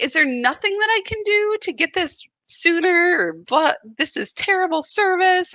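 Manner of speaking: exasperated customer griping; irritated complaint. Retold or imitated. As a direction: push back at customer service. Retold complaint to support.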